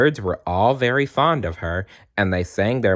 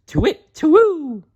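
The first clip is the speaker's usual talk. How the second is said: very high, loud hooting call; owl hoot; 6 dB louder than usual. Acted. Owl peering down from his tree, hooting loud and high.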